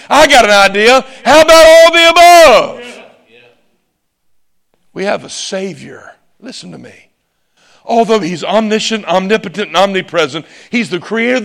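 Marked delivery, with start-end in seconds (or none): 0.1-3.0 rising to a loud, high shout; preaching shout. Real, not acted. Climax answering the debated questions. 4.9-7.1 dropped to a very soft voice; hushed earnest. Real, not acted. Sudden hush after the shout.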